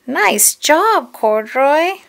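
high, loud, lilting congratulations; enthusiastic praise. A narrator's reading. Reader praising Corduroy's painting, delighted. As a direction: cheer on a little one.

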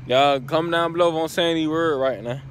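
normal talking voice

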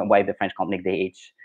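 ordinary talk, nothing marked

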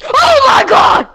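loud startled cry; startled boo reaction. Real, not acted. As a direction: react to a jump scare. Startled by friend.

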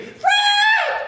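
high, loud comic scream of a name; hammed-up wife shrieking across the store; wife yelling her husband's name, high and loud. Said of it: "starts screaming".

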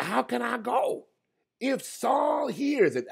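high, slow anxious question; fearful plea. Retold or imitated. Samuel anxiously asks God how he can go. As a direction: ask it high and scared, drawn out.

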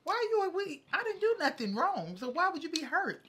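defensive. Real, not acted. Defensive protest in argument.

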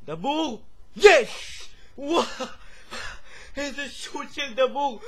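high, loud, disbelieving celebration; triumphant goal shout. Real, not acted. Gamer celebrating Dabbur's shot, shouting in amazed triumph. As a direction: explode with joy, then marvel loudly.

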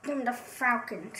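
softly repeats the pick under his breath; muttered echo. Pretend. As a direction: toss it off quietly, almost to himself. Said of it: "that's a little strange".

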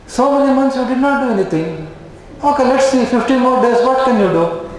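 high, mocking taunt to a patient; sarcastic taunt. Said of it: "What will be the sarcastic person tell".

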